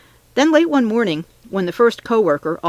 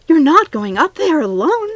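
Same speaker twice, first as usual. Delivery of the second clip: high, loud, drawn-out disbelief; panicked, incredulous shriek; alarmed shriek; 10 semitones higher, 6 dB louder than usual. A narrator's reading. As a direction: shriek it slowly in horror. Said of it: "She shrieked again".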